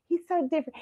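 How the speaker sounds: grandma voice. Retold or imitated. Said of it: "She said".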